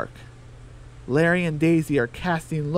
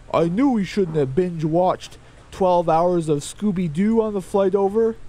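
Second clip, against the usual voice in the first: a touch higher, slightly altered chicken voice; mildly raised voice for Larry complaining about the trip; lightly voiced Larry; 3 semitones higher, 4 dB louder than usual. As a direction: nudge the voice up for the grumbling rooster. Larry voiced in read story.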